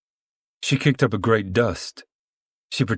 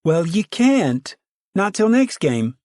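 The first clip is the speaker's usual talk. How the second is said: put-on young girl; 11 semitones higher, 3 dB louder, slower than usual. Pigtailed girl refuses Ruthie.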